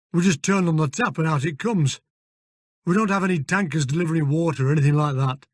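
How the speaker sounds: put-on broad northern English accent; gruff Yorkshire-style stable manager. Fergus voiced in northern accent.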